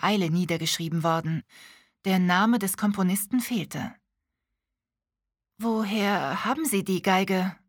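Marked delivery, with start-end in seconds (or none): 5.6-7.6 lightly voiced bewildered asking; a touch of confusion; confused question. A narrator's reading. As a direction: ask it a little thrown. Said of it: "fragte Lilly verwirrt".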